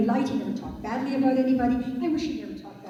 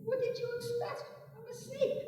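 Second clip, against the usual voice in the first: much higher, softer and matter-of-fact; smug snake; 12 semitones higher, 8 dB quieter than usual. Acted. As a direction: shrug it off coldly. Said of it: "he said".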